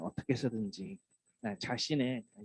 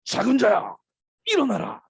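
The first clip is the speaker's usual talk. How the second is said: high, loud, booming command; grand proclamation; 10 semitones higher, 10 dB louder than usual. Shown as a demonstration. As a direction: proclaim it like a mighty miracle. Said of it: "어떻게 선포하셨을 것 같으세요". (위엄 있게 크게 외치는 선포)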